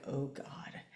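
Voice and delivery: low, barely voiced dismay; hushed dread. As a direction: sink into a near-whispered retold dread. Retold dread on hearing screams.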